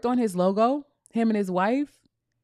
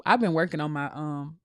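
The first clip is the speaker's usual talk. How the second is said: ordinary talk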